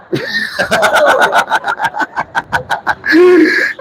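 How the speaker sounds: helpless high laughter. Real, not acted. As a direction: burst out laughing, almost crying. Laughing at his own mockery.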